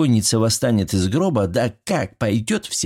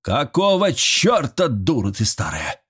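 mock yell, high and drawn out, then dropping; sudden angry yell; 5 semitones higher than usual. A narrator's reading. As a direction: burst out indignantly without much volume. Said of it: "внезапно заорал председатель".

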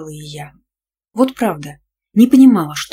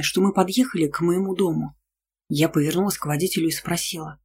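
ordinary delivery, nothing marked

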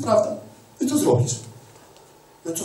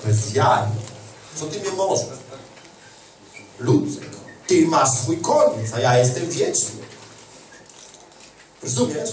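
mocking the devil; 5 semitones lower than usual. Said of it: "Diabeł przychodzi i cię straszy".

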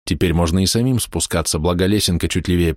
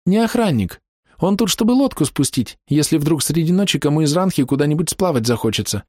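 put-on high, clever girl explaining; lightly voiced smart girl; little girl voice; 9 semitones higher than usual.

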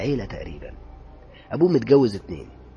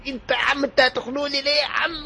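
much higher, louder panicked cry; retold scream; 6 dB louder than usual. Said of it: "قعدت أصرخ". (صرخة مذعورة وهو بيتخنق)

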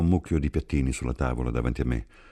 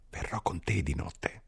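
struggling to speak; 5 semitones higher, 7 dB quieter, slower than usual. A narrator's reading. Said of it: "Le riusciva molto difficile parlare".

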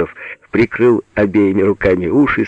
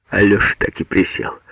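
ordinary delivery

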